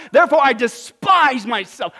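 loud, high, declaimed self-reproach; impassioned response. Acted. Preacher reads Job's answer aloud, forceful. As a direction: proclaim it at full preaching force. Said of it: "What's his response?".

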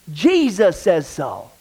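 slow, weighty Jesus says so; emphatic punchline. Real, not acted. Pastor answers his own question to the congregation, slow and weighty. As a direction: land it slowly, like the final word.

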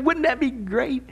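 soft, slow sarcastic jab. Real, not acted. Pastor mocks the push for one world rule, softly sarcastic. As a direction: let the sarcasm drip, quietly and slowly.

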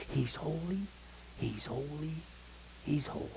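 very soft, awed "he's holy"; hushed reverence. Real, not acted. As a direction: let each repeat drop to a near-hush. Hushed reverent repetition after climax.

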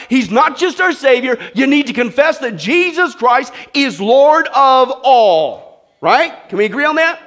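loud, high climax of the sermon; preaching at full force. Acted. Preacher pressing the congregation to confess Jesus as Lord, loud climax. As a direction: drive it home to the church, slowing on Lord of all.

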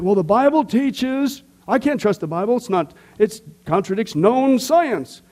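high, slow, whiny skeptic voice; hammed-up skeptic sneering at the Bible; mocking skeptic. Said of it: "they have this premise like the Bible somehow contradicts science".

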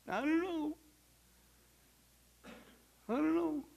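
high, soft mock hesitation; small, high voice of uncertainty; doubtful. Believer with hands in the pot, doubtful. Acts doubt when at fault.

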